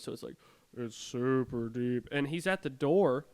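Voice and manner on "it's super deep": slow, drawn-out mock deep voice; imitated deep voice. Imitating brother's friend at the bathroom door, mock deep voice. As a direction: lightly put on a deep voice, stretched out. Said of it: "he's got a super deep voice".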